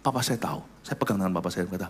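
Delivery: ordinary talk, nothing marked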